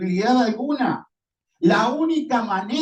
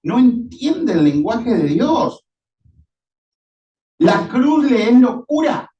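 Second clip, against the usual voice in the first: louder, forceful proclamation; preaching at full force; 3 semitones higher, 7 dB louder than usual. Real, not acted. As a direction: proclaim it to the whole congregation. (predicación a todo pulmón)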